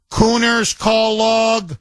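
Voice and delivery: high, drawn-out, sing-song announcement; slow mock-grand radio intro; segment announcer. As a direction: stretch each word out on a high held note.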